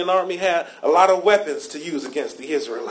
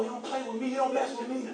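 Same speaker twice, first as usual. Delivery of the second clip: much softer, calm, half-laughing warning; soft retold warning; 5 semitones higher, 8 dB quieter than usual. Pastor recounting warning to boy, much softer and calm.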